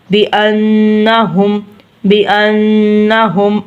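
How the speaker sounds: "بانہم" slow with a long hummed n; ghunna. Shown as a demonstration. Teacher demonstrating ghunna, held nasal n. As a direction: hold the n of "بانہم" through the nose. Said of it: "ادھر بھی ہم گننا کریں گے".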